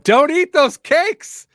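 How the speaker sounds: high, loud comic yell, drawn out; hammed-up shouted plea, stretched slow; mock screaming. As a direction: scream it out as a protesting foot. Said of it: "my right foot is just screaming".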